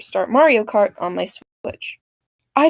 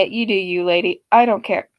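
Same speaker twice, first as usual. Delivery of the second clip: ordinary talk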